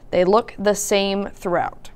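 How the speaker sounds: normal talking voice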